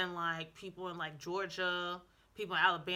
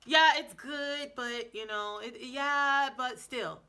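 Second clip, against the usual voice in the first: drawn-out imitated reluctant concession; ornery, grudging praise; 4 semitones higher, 7 dB louder, slower than usual. Imitating ornery Germans judging her German, grudgingly. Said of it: "they be a little ornery".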